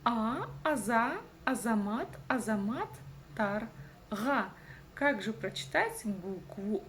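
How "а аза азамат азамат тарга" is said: slow build-up of "азамат", then "тарга" apart; teacher's drawn-out reading drill on "азамат"; syllable by syllable. Shown as a demonstration. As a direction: stretch and separate "А, аза, азамат, тарга". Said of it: "нужно разделить по слогам".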